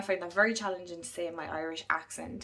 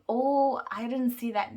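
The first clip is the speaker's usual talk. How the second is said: high, loud mock gasp of surprise; 6 semitones higher, 6 dB louder than usual. Imitating a reader shocked by twists, mock surprised. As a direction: play up being caught off guard. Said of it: "kind of going".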